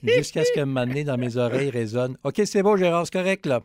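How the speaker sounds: loud, high-pitched urgent cut-off; excited. Retold or imitated. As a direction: mimic the voice in his earpiece hurrying him along. Producer cuts him off in earpiece.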